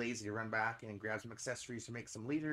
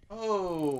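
startled oh; 4 semitones higher, 9 dB louder than usual. Real, not acted. Huge fish suddenly hits line.

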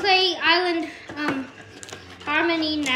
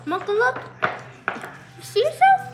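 talking to a dog; 10 semitones higher than usual. Real, not acted.

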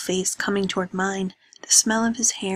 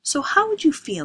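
higher and louder, accusing; indignant rising demand; angry challenge; 7 semitones higher, 4 dB louder than usual. Acted. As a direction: throw the question at her. Andrews challenges Lena angrily.

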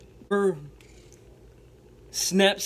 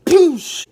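loud high crash noise; imitated crash boom; 11 semitones higher, 11 dB louder than usual. Storyteller imitating the crash of the motorcycle, loud. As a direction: blast it out like an impact.

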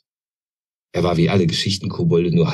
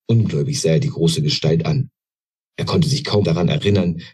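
plain reading voice

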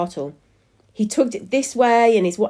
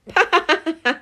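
high, gloating cackle; 10 semitones higher, 4 dB louder than usual. A narrator's reading. Husband delights at the smashed pumpkin, cackling. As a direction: cackle in quick bursts. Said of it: "Mister Croon cackled".

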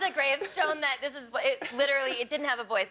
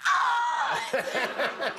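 high yelped ah of pain; sudden high yelp, half laughing; mock pained yelp. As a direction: yelp it like you just got hit. Dad hit in crotch, retold.

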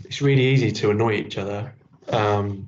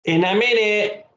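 put-on high-pitched child's call back; understated shout in a high little-boy voice; mild shout; 10 semitones higher than usual. A narrator's reading. Billy answering Mum downstairs, mildly shouting. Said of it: "shouted Billy".

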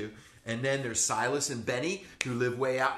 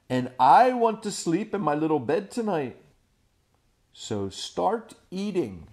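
stern Stregonona; 5 semitones higher, 7 dB louder than usual. Acted. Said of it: "Stregonona said".

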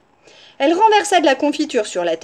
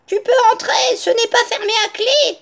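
high-pitched granny voice; grandmother's high call through the door; 11 semitones higher, 4 dB louder than usual. A narrator's reading. Grandmother calls back to the wolf.

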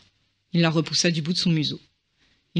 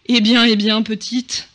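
louder, booming dragon call; thundering voice; 4 semitones higher, 7 dB louder, slower than usual. A narrator's reading. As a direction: boom it down from above at the small girl. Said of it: "tonna la voix au-dessus d'elle".

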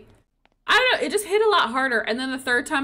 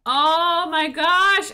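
slow, drawn-out, louder exclamation; exclaiming; 4 semitones higher, 5 dB louder, much slower than usual. Real, not acted. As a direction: stretch it out loudly in amazement. Reacting to stream alert.

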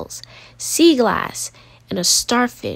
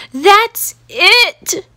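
high, loud exasperated cry; fed-up outburst; 9 semitones higher, 5 dB louder than usual. Acted. Gideon giving up on the crowded spot, fed-up cry. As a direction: snap like you've had enough.

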